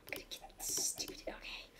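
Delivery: soft whispered aside. Real, not acted. Muttering to herself mid-game, whispered. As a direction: breathe it under your breath.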